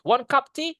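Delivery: "One cup tea" high, each word punched; stressed content words. Shown as a demonstration. (penekanan tinggi pada "One cup tea")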